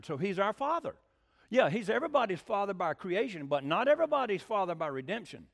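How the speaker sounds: ordinary delivery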